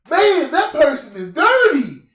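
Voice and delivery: high, loud, slow imitation; mock childlike exclamation. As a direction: announce it in a hammed-up, drawn-out cry. Imitating man's childhood remark at Walmart.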